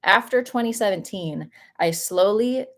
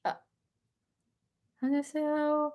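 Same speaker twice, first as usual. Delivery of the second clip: high, soft little hello; tiny high-pitched polite greeting; timid reluctant greeting; 8 semitones higher, 6 dB quieter, much faster than usual. Retold or imitated. Runner replaying her greeting to strangers in her path, small high hello. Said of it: "I just was like".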